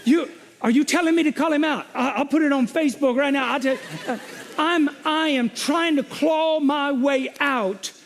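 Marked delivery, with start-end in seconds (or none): 0.0-6.0 mock ranting to God. Pastor retelling his rant to the Lord, worked-up high complaint. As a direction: throw a friend under the bus, voice up high.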